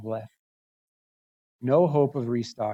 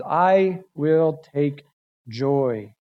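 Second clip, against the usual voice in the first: slow quote; much slower than usual. Shown as a demonstration. Quoting verse slowly.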